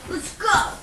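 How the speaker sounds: high, punchy hype call; excited. Real, not acted. Vlogger about to unbox the fryer, excited call. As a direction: launch into it with eager energy.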